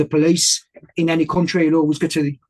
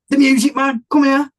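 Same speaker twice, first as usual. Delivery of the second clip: calling out; 8 semitones higher than usual. Retold or imitated. Retold guy calling him over.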